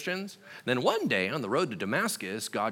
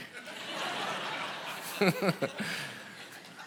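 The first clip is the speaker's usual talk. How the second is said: a high, unvoiced, wheezing laugh; breathless laugh; 9 semitones higher, 5 dB quieter than usual. Real, not acted. Preacher cracks up at his own Blockbuster story, wheezing laugh. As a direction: laugh silently, almost out of air.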